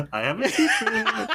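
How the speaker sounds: high, giggling through the words; laughing. Real, not acted. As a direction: laugh while confessing it. Giggling about mustache secret.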